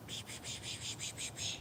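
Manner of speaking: soft unvoiced psh-psh to lure a cat; cat-calling psh. Real, not acted. Luring his cat Lucky, soft psh-psh sounds. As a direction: hiss psh psh to call the cat.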